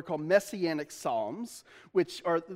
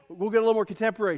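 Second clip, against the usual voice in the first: ordinary talk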